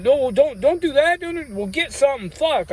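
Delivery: high-pitched retold pleading; urgent protest. Others stop him dropping it.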